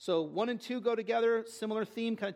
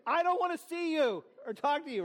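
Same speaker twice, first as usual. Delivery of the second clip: mock refusal; 6 semitones higher than usual. Acts out refusing a visitor.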